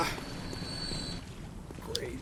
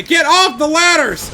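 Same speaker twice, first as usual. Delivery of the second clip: frustrated shout; 9 semitones higher, 8 dB louder than usual. Real, not acted. Falling off ladders in game.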